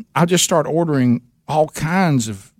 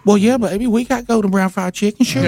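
much higher, sweet and doting; waitress voice; 9 semitones higher than usual. Retold or imitated. Waitress offering fried chicken to caller, high and doting. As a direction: dote on him like a favorite customer. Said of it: "hear her voice saying to me".

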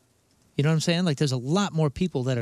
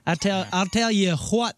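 put-on country accent; 5 semitones higher than usual. Putting on country talk.

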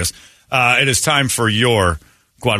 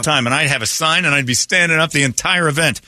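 bright, high on-air host voice; radio announcer; 7 semitones higher than usual. Acted. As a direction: pump up the morning audience. Radio host hyping contest prizes.

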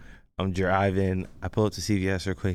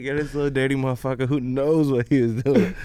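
disgusted; 5 semitones higher, 3 dB louder than usual. Retold or imitated. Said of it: "I'm like".

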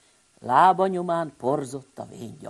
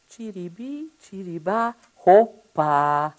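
magic words; 4 semitones higher, 7 dB louder, slower than usual. Pretend. Magic words opening the book.

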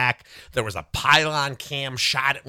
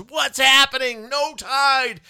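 high, loud, slowed panic of a fan; panicked Alabama fan; 13 semitones higher, 4 dB louder, slower than usual. Retold or imitated. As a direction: cry out in disbelief as a fan.

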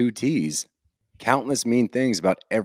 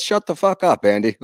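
mock yell; 6 semitones higher, 4 dB louder than usual. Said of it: "And then she goes".

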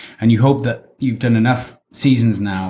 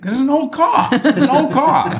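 high-pitched mock mechanic's complaint; comic high impression of a complaining mechanic; imitated mechanics. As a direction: mimic exasperated mechanics in a squeaky, laughing voice. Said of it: "they all say the same thing".

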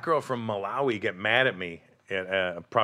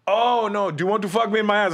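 higher and louder, fast mock come-on; high hammed-up hooker voice; 11 semitones higher, 7 dB louder than usual. Comic retelling of a club girl's pitch, higher and louder. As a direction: ham up the insistent club girl. Prostitute voiced in retold story.

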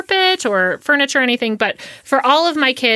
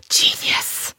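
sudden whispered genius, half to herself. Real, not acted. Podcast host whispering her awe at a friend's photo-frame trick. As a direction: breathe the word out in awe. Excited whispered praise of a hack.

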